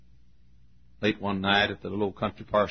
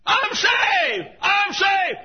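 very high, loud cry of relief; joyful shout; 6 dB louder, much slower than usual. Retold or imitated. Ruth to preacher after trusting Christ: joyful high shout. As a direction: burst out in joy. Woman rejoicing after conversion.

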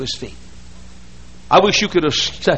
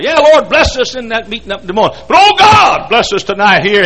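loud, high, hammed-up praying; mock preacher's prayer; 6 semitones higher, 8 dB louder than usual. Preacher imitating Billy Sunday praying aloud, loud. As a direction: bellow the prayer to heaven.

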